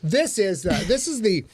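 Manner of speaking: lilting home-shopping presenter patter; hammed-up shopping channel pitch; QVC host. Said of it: "welcome to the Q V C channel".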